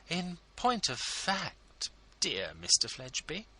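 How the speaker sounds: high, effusive ladylike voice; fawning hostess; gushing society lady. A narrator's reading. Mrs Lammle voiced by narrator.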